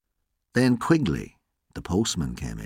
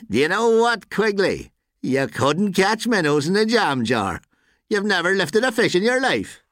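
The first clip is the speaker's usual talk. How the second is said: high-pitched jeer called after him; raised, high, goading character voice; taunting shout; 11 semitones higher than usual. A narrator's reading. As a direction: shout mockery at someone walking out. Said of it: "shouted after him".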